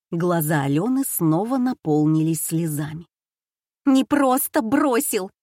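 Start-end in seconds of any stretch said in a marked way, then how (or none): 3.8-5.3 выше и громче, с надрывом; завопить в слезах; вопль. A narrator's reading. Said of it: "завопила она". (обиженный вопль)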